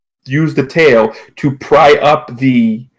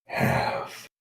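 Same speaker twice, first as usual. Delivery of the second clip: distrustful captor; 6 dB quieter than usual. Acted. Captor doubting the talking otter's promise, softly distrustful. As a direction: let it sink to a slow, quiet murmur.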